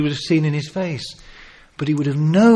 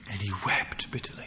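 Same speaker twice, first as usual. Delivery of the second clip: hushed, soft whisper; 7 semitones lower, 13 dB quieter than usual. Real, not acted. Preacher telling of Peter's weeping, soft whisper. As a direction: breathe the words. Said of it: "unvoiced whisper".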